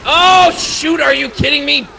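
high, loud panicked yell; shout. Real, not acted. Charged by a zombie, shouting.

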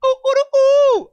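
high, loud cock-a-doodle ooh; mock rooster call, hooting high; rooster crow imitation. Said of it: "by the sound of the chicken".

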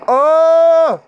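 loud, high real scream of fright; terrified scream. Real, not acted. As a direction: scream at the jump scare. Jump scare in horror game.

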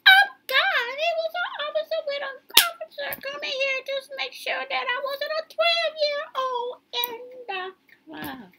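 high, loud, rushed panic; panicky. Retold or imitated. Retold panic at police check.

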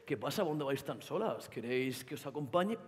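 mocking a catcaller pestering girls on the street; creepy street catcaller. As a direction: lay on a sleazy murmur. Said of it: "viene el ingenioso comentario".

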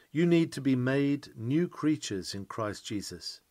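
usual speaking voice

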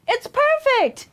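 high, happy exclamation; high-pitched cheering animals; delighted cry. A narrator's reading. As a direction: cry out with joy. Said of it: "the animals cried".

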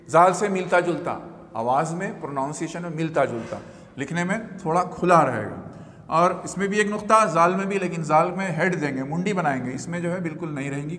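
normal talking voice

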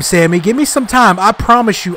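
usual speaking voice

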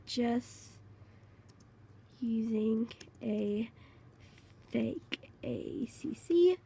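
slow unvoiced whisper; sneaky confession to viewers; secret whisper. Real, not acted. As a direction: confide it under the breath.

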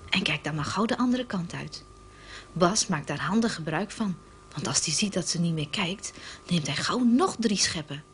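normal talking voice

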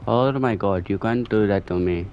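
ordinary talk

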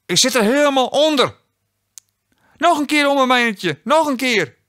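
high cartoon child voice; squealing child. A narrator's reading.

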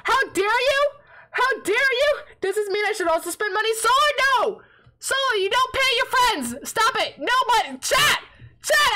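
high-pitched, exasperated telling-off; indignant scolding. Real, not acted. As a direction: scold them in worked-up mock outrage. Mock scolding chat for giving money.

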